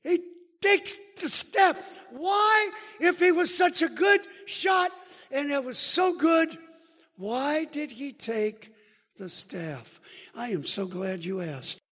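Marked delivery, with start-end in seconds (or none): 0.0-10.0 rising pulpit voice, slow at the question; fervent sermon question; preaching. Acted. Preaching at full force.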